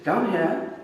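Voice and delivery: put-on a small child lightly giving orders; little girl's command. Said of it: "this little girl".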